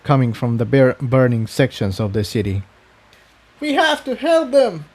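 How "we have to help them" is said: high, urgent shout; pleading shout for help. Acted. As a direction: call out to stop the fire. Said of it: "shouted Incendia".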